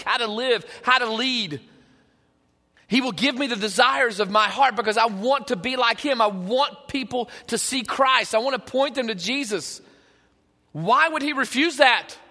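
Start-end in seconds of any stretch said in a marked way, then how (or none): none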